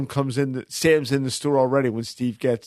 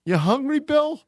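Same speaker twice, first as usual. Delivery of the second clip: playful banter; 8 semitones higher than usual. Retold or imitated. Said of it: "And he goes".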